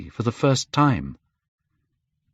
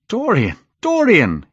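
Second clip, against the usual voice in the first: high, louder pleading call; cried out; 11 semitones higher, 5 dB louder than usual. A narrator's reading. Hallward crying out to Dorian, high and loud. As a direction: call him back in alarm. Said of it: "he cried".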